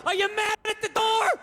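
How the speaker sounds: mock dog shouting at the door; confused dog. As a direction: play the dog baffled at the door.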